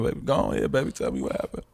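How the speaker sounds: mock soothing late night radio voice. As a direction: coo it to him like a late night radio host. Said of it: "In a soothing voice".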